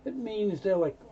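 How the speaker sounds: normal talking voice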